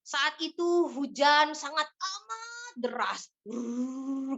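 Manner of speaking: slow, drawn-out storyteller voice ending in a "brrrr" rain sound; mendongeng hidup. Shown as a demonstration. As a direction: paint the heavy rain for a child as you tell it. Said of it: "ketika kita membacakan kisah". (mendongeng pelan dan ekspresif dengan bunyi hujan)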